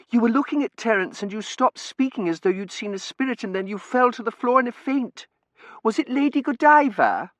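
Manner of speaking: put-on high, loud girlish voice; excitable daughter. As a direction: gabble the story in high agitation.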